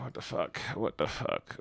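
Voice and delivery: hushed, stunned whisper; whispered disbelief. Real, not acted. Streamer seeing fan art, whispered disbelief. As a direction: whisper it in shock.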